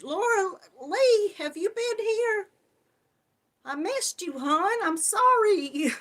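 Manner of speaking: squealing high welcome. Real, not acted. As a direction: pitch it way up to greet a friend. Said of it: "I'll get up to my high voice".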